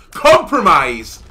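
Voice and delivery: slow, high, loud outraged yell; outraged shouting. Real, not acted. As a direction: shout it out in comic fury. Ranting about withheld sandwich.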